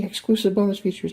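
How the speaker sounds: bonus stressed in a high, thrilled voice; excited. Real, not acted. Collector thrilled to repeat the bonus features, excited. Gushes over repeated label text.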